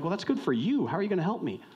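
high-pitched, put-out challenge; sceptical retort. Acted. Anxious client to defense attorney, sceptical. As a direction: challenge the helper, unconvinced.

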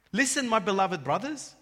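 loud, slow, swelling proclamation; fervent scripture reading. Acted. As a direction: proclaim the verse to the brothers. Reads scripture with rising force.

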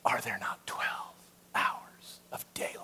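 a hushed, unbothered reply to frightened disciples; calm Jesus answer. Retold or imitated. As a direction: breathe it out as a serene whisper. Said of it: "gives them a Jesus answer".

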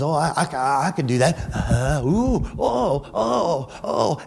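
stammering as if shaken, breaking into oohs; mock shaking. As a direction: act out mocking shakes that take over. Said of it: "he starts shaking himself like this".

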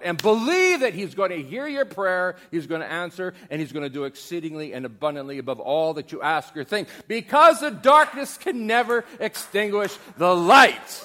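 loud, high, slowing to the climax; thundering sermon peak; full-force preaching. Acted. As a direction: drive the promise home.

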